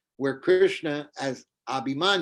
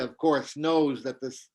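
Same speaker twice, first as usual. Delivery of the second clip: plain reading voice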